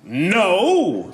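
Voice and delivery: superhero's righteous no; mock heroic. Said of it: "A superhero being propositioned by a hooker".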